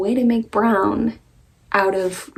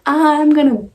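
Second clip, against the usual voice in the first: joking voice; 5 semitones higher, 5 dB louder than usual. Retold or imitated. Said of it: "like, jokingly".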